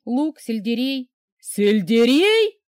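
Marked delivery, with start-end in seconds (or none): 1.4-2.6 loud, slow howled question. A narrator's reading. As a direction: howl the word in horror. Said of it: "взвыл Дмитрий Олегович".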